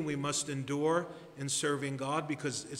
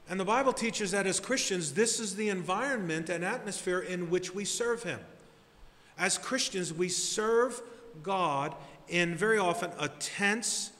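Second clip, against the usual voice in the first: higher and louder, building with each phrase; fiery preaching; 5 semitones higher, 3 dB louder than usual. Real, not acted. Pastor rousing his congregation about serving amid hardship, at full preaching force. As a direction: preach it with rising fire to the climax.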